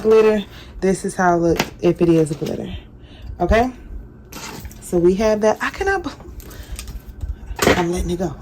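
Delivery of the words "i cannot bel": much higher, softer squeak of disbelief; high cut-off disbelief; exasperated disbelief. Real, not acted. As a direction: break off in a high incredulous squeak. Exasperated at herself.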